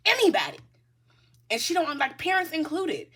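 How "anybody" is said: "anybody" shouted much higher; hard stress. Real, not acted. Reader insisting to viewer anyone gets cut off, loud. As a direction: punch "anybody" loud and high.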